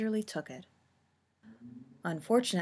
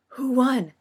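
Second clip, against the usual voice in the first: loud, higher gasped question; raised, breathless-style exclamation; 5 semitones higher, 10 dB louder than usual. A narrator's reading. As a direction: gasp it out, urgent to know. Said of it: "Agnes gasped".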